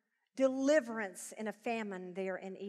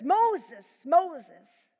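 a raised, ringing call of the name; 5 semitones higher, 7 dB louder than usual. A narrator's reading. God calling to Moses from the bush, ringing call. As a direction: call across the distance, a touch louder. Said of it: "God called out to him from out of the bush".

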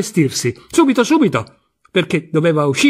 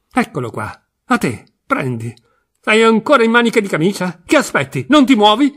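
urgent nagging; 5 semitones higher than usual. Acted. Wife hurries husband out.

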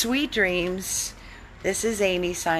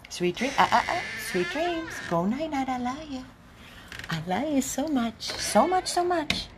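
much higher, softer loving coo; 6 semitones higher, 6 dB quieter than usual. Real, not acted. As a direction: coo goodnight to the babies. Cooing goodnight to dogs.

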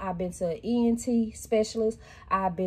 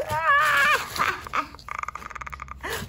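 giddy laugh. Real, not acted. Giddy laughter over cute toppers.

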